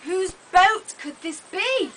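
usual speaking voice

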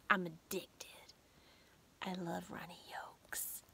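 confiding whisper, like a secret; playful whisper. Real, not acted. To viewers, about her eggs and crackers, whispered. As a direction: let it slip out as a hushed confession.

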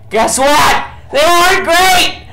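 ranting gamer fury; angry rant. Real, not acted. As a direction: blow up about the torture. Ranting about bad golf rounds.

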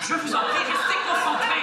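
high-pitched, exasperated plea. Acted. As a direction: beg him to focus, voice climbing. Exasperated coach scolds distracted pupil. (supplication exaspérée)

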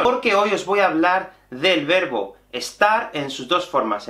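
usual speaking voice

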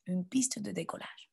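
soft, hushed repeat; quiet. Shown as a demonstration. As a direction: drop to a hush for the echo. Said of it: "Répétez". (à voix basse)

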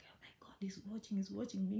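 hushed nervous whisper. Retold or imitated. Vlogger imitates herself fearing her husband is watching, hushed whisper. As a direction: whisper it as if he might overhear.

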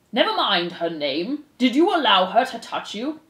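preside with weighty, deliberate authority; stern head mouse. Acted. Head mouse puts rumors to the accused, stern and formal.

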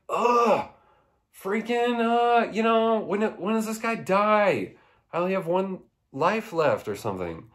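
high-pitched mock exasperation; frustrated gamer. Player fed up with an unkillable video game enemy, high exasperated whine. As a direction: whine at an unbeatable boss. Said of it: "I'd be like".

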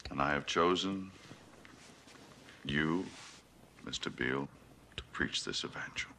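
low voice fading toward a near whisper, long pauses; hushed evangelism. Acted. Selling Beale a perfect world to preach, hushed evangelism.

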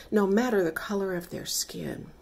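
ordinary delivery

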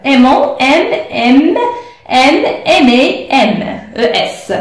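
slowly spelling each letter; slow spelled out. Shown as a demonstration. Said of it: "أنا صرفت لي دابا". (تهجية ببطء حرفا حرفا)

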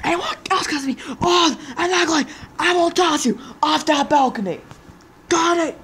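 angry threat. Acted. Threatening the others.

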